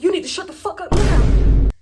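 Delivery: mimicked irate yell; imitated angry order. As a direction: shout it down like the doorman. Acts out door guy's angry order.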